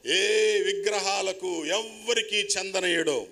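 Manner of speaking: slow, weighty reading of God's own words; solemn scripture reading. Acted. Preacher reading the verse aloud to the church, solemn and slow. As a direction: let each phrase land slowly, like a proclamation.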